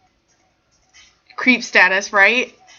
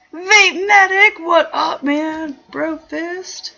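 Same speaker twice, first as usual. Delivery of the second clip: high, drawn-out shout-out; hearty greeting; 7 semitones higher, 4 dB louder, much slower than usual. Real, not acted. Host greeting Vape Medic as a bro, high excited shout-out. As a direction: call out the name long and high. Calling out greeting to arriving viewer.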